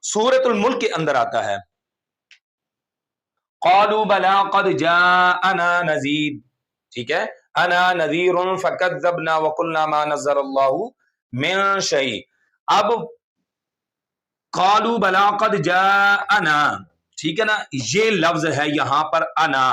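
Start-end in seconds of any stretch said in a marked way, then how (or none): 3.6-6.4 slow tilawat with elongated vowels; measured, sustained verse recitation; Quran recitation. Shown as a demonstration. 7.6-12.1 sustained tilawat with held vowels; careful, measured Quranic reading; Quran recitation. Shown as a demonstration. Recites a Quran verse as example. 14.5-16.6 slow tilawat with elongated vowels; measured, sustained verse recitation; Quran recitation. Shown as a demonstration.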